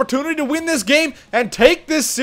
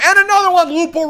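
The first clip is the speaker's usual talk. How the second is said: excited call; 8 semitones higher, 3 dB louder, faster than usual. Real, not acted. Gamer cheering a goal for his team, excited.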